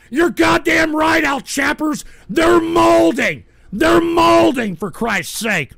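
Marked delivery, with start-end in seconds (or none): 0.1-4.8 high, loud, drawn-out yell; triumphant shouting. Real, not acted. Streamer agreeing with Al Chappers about trolls, loud shouting. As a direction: stretch and belt out each phrase. Gloating that trolls are mad.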